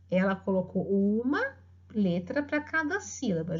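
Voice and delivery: usual speaking voice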